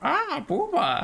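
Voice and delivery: put-on high, slow lecherous old man; Master Roshi impression. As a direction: drag it out like a pervy old man. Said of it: "I love titties".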